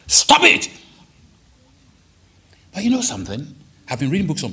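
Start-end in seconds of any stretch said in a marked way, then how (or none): 0.1-0.7 high sharp rebuke; sharp shout. Acted. Prophet rebuking the king with a high shout. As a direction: bark it at him. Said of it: "some version says".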